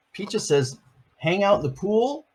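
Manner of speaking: plain reading voice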